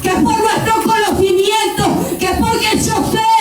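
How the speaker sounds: high, shouted fervent preaching; preaching at full force. Acted. Preacher exhorting the congregation, fervent shout. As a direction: climb into a passionate shout to the church.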